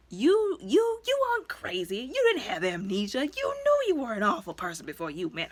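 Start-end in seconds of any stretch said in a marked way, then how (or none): 0.1-2.0 much higher, exasperated outburst; incredulous rant. Retold or imitated. Viewer scolding the hero on screen, high exasperated rant. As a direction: scold him in disbelief.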